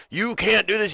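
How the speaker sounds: high-pitched mock objection; court officials objecting. Court telling Ricky to stop, mock-scandalized. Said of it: "and they're like".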